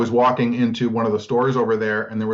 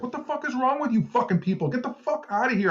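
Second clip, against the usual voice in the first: much higher, furious telling-off; retold angry outburst; 13 semitones higher than usual. Yelling at people mocking a hurt pigeon, high-pitched fury. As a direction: chase the jeering crowd off, outraged. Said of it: "verbally attacked all these people".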